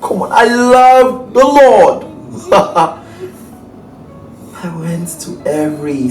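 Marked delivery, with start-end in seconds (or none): 0.0-2.1 manic zeal. Acted. Villain monologue, mocking zeal.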